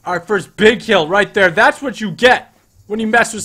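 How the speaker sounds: triumphant, very high victory yell; excited shouting. Real, not acted. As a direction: scream it out like a big win. Celebrating the first kill.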